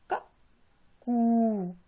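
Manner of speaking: कू held long after a short क; teacher stretching कू for pupils; long oo demo. Shown as a demonstration. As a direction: draw out the oo in कू. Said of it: "इसको बोलते समय ज़्यादा समय लगता है".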